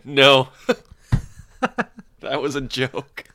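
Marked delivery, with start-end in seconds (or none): 0.0-0.5 retold reply. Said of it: "so I just said".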